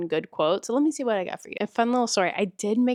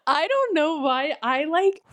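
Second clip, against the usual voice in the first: flustered giddiness; 7 semitones higher than usual. Real, not acted. Flustered after laughing.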